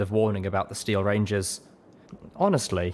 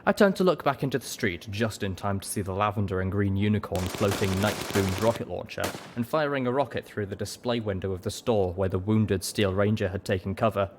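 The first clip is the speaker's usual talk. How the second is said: ordinary delivery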